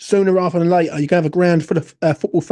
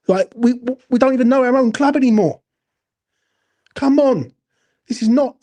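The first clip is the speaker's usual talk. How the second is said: rising anger; 5 semitones higher than usual. Real, not acted. West Ham fan venting to viewers about the club, higher and heated. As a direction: pitch climbing as the frustration boils over.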